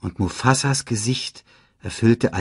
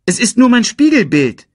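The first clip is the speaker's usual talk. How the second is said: high, loud complaint; upset protest; 14 semitones higher, 9 dB louder than usual. A narrator's reading. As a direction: protest loudly, let down. Disappointed Simba protests to Rafiki. (aufgebracht und enttäuscht klagen)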